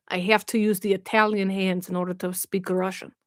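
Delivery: low, hammy Russian accent. Shown as a demonstration. Streamer trying out a Russian accent for viewers, hammed-up Russian. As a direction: put on a rough comic Russian accent. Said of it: "I've gotta do my Russian accent".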